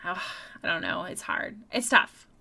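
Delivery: normal talking voice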